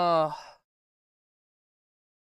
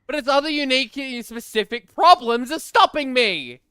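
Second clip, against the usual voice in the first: raised, loud protesting voice; exasperated retort; 6 semitones higher, 7 dB louder than usual. Real, not acted. Streamer retorting that game problems stop him, exasperated. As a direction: throw the friend's criticism back loudly, exasperated.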